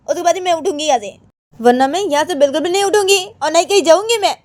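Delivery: put-on high, loud sulky girl's voice refusing to budge; high-pitched pouting refusal; stubborn Krishna. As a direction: whine it out high like a stubborn girl who won't get up. Krishna sulking on the temple.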